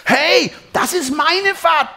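sehr hoch und laut, gedehnt; brüll empört wie ein kleines Kind; brüllendes Kind. A narrator's reading. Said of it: "brüllt Pin". (lautes Kindergebrüll)

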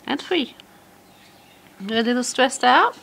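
high, sweet praise for a bird; pet talk voice. Real, not acted. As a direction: coo at a budgie. Asking the bird.

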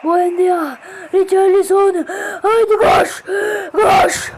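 loud, high excited commentator call. Pretend. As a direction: yell it like a live commentator. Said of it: "Kazan sesi yapmakta birazcık ustayım".

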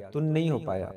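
normal talking voice

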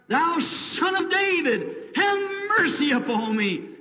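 high, slow drawn-out plea; crying out. Retold or imitated. Blind beggar cries out again to be heard. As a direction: stretch every word, begging. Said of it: "And he cried".

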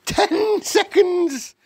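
very high, loud, stretched shriek; witch crying out. A narrator's reading. Witch counting down to the transformation, crying out. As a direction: screech the countdown slowly. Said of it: "cried the Grand High Witch".

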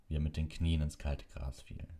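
ordinary delivery

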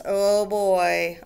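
usual speaking voice

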